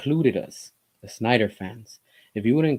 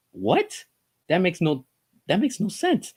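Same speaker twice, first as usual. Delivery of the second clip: mock disbelief, climbing higher on the repeat; acted bafflement; 7 semitones higher than usual. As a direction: play a baffled executive who can't follow. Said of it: "I would be so confused and so lost".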